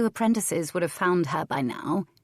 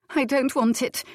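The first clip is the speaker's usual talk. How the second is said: cried out; 7 semitones higher, 3 dB louder than usual. A narrator's reading. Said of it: "she cried".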